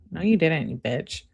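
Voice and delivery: ordinary talk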